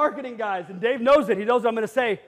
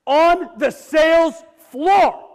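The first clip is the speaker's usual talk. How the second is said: shouting the answer; 6 semitones higher, 8 dB louder, much slower than usual. Real, not acted. Answers his own question to audience.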